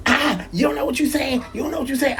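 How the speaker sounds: high, dismissive imitation of the wife; mock wife voice. Husband mimics wife brushing him off, high mocking voice. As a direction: squeak out the wife's brush-off, mocking her.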